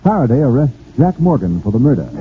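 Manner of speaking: ordinary talk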